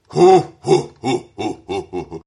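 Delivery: loud ho ho ho fading; jolly belly laugh dying away; Santa laugh. Shown as a demonstration.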